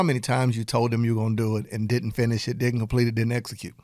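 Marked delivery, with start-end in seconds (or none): none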